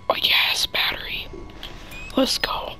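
tense gamer whisper. Real, not acted. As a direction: hush it so nothing hears.

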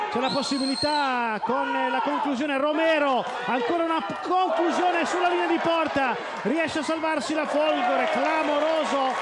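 high, urgent shouted call; excited play-by-play. Real, not acted. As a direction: ride the scramble at the goal line. Goal-line scramble called live.

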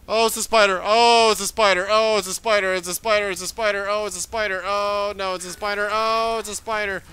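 loud, high, frantic repeating; panicked. Real, not acted. Player spots a spider and panics, loud and high. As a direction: blurt it again and again in alarm.

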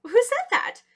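high, loud puzzled question; startled animals. A narrator's reading. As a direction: call out in surprise at the unknown voice. Startled animals ask who spoke.

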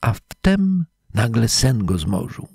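ordinary delivery

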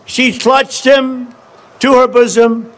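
measured storytelling read, slowing on the snake; a slow, dramatic poem read; poem recitation. Acted. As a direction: recite it like a fable to the crowd. Continues reading the poem.

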